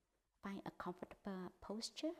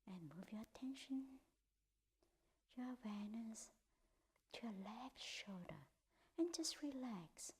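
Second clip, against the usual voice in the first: guided meditation; 2 semitones higher, 6 dB quieter than usual. Acted. Teacher guiding the group through a body scan, in a hushed whisper. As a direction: ease them through the body, part by part.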